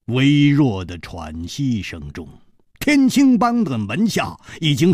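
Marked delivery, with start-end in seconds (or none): none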